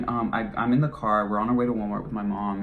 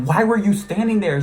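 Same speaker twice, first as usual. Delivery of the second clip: incredulous yell; 11 semitones higher, 9 dB louder than usual. Retold or imitated. Scolding friend afterwards.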